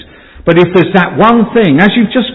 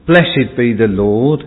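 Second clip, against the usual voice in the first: scripture reading; 5 semitones lower than usual. Acted. Scripture read aloud to congregation.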